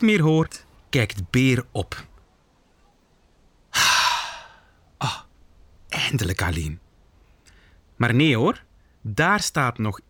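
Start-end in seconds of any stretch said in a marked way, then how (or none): 3.7-4.3 long, voiceless exhale; relieved sigh. Acted. Bear finally alone, relieved. 5.0-6.8 relieved. Acted. Bear relieved to be alone.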